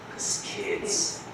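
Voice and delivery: hushed, slow whisper. Shown as a demonstration. Teacher whispering the answer to the class. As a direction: breathe it out slowly. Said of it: "repeated as whispered punchline".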